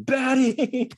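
high-pitched drawn-out mock scream; imitated scream. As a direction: yell the name out in imagined exasperation. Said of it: "imagining Roger just screaming".

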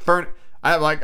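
ordinary talk, nothing marked

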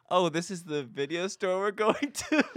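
high, slow, drawn-out questioning; let-down disbelief. Retold or imitated. Kids arriving at church bookstore, high drawn-out disbelief. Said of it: "And we're like".

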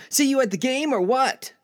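high, eager buddy voice; upbeat, high-pitched invitation; friend's casual shout. Acted. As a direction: toss it out high like a pal in passing. Acted friend in casual exchange.

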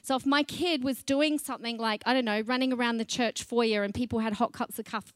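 ordinary delivery

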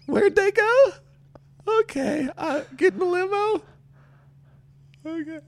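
tiny, very high mock-helpless voice; dazed jilted contestant. Podcaster acting out a dumped contestant sent to the limo, high dazed squeak. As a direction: squeak it out in small lost pieces.

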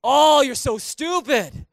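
loud, high, drawn-out mock put-down; exaggerated degrading outburst; acted insult. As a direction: hurl a hammed-up insult, stretching the words. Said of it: "degrading or abusive language".

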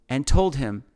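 usual speaking voice

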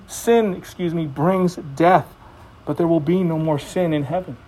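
ordinary talk

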